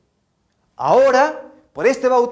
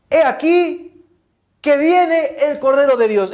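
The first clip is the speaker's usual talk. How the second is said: raised, proclaiming announcement; prophet's announcement; 5 semitones higher, slower than usual. Acted. Preacher as John the Baptist announcing the Lamb, high proclamation. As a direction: announce it like a herald, pitched high.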